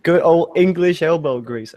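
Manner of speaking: a clumsy mock English accent; bad English accent. A bad English accent to mock the lasers. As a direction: ham up a posh English phrase badly. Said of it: "my fucking accent's terrible".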